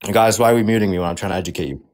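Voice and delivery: talking while chewing. Real, not acted. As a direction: talk through a mouthful. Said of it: "you're literally chewing".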